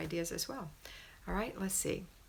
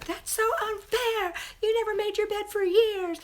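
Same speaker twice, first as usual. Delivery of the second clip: put-on two-year-old tantrum; 9 dB louder than usual. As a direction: throw a hammed-up child's fit. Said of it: "back bend fit like a two-year-old and say".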